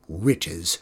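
low, sour grumble to herself; grumbling. Acted. Griselda scorning the visitor's promise of riches, a low grumble. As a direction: mutter the word with disdain. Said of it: "she grumbled".

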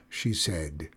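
ordinary delivery

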